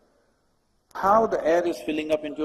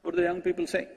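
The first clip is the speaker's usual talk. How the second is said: ordinary talk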